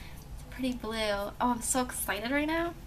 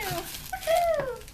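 cheer; 12 semitones higher than usual. Real, not acted. Cheering over gifts.

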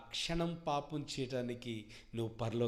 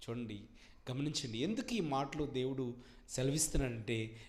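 ordinary delivery

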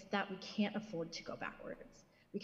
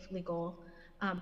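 ordinary talk

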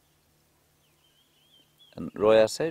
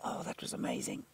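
awed whisper; 5 dB quieter than usual. Real, not acted. Cub emerges, guide thrilled.

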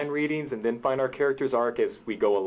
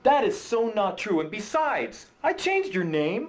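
high-pitched indignant denial; protesting; 8 semitones higher than usual. Acted. Writer denying character assassination, indignant protest. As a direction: argue back in a raised pitch.

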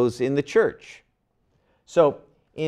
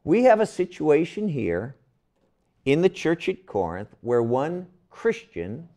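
normal talking voice

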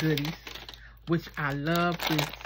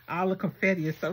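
ordinary delivery

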